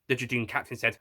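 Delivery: ordinary delivery, nothing marked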